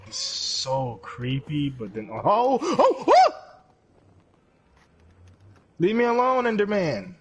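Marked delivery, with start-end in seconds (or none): 2.1-3.3 frightened shriek. Real, not acted. Enderman appears suddenly. 5.8-7.2 high, loud frightened yell at the monster; scared shout. Real, not acted. Gamer fleeing the Enderman, shouting in fright.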